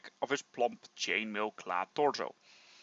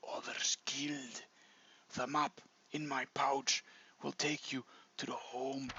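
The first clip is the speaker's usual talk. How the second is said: dying whisper, much softer; low whisper; 8 dB quieter than usual. Acted. Dying dwarf pleading with the hero, whispered. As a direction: breathe the words out, barely voiced. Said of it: "in a low whisper".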